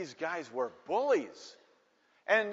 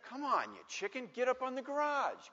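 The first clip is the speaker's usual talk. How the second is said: high, softer mocking goad; teasing; 8 semitones higher, 4 dB quieter than usual. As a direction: needle a reluctant kid in a pitched-up voice. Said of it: "they're teasing me".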